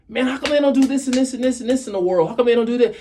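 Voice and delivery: high, loud complaining rant; 不満げな訴え. Acted. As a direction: rattle off an exasperated, high-pitched grievance. Mimics a complainer questioning the world.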